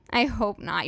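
ordinary talk, nothing marked

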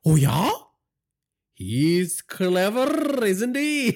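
high, slow mock surprise with a laugh; feigned shock. A narrator's reading. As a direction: pretend to be impressed. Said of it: "feigning shock at the revelation".

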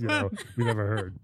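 ordinary delivery

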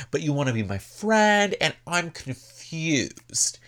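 high-pitched, hammed-up puzzled complaint; mock confrontation. Heather confronting Noella over trust, high mock bewilderment.

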